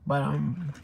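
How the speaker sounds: talking while crunching chips; munch on chips between words. Real, not acted. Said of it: "I'm sorry, I'm crunching like that".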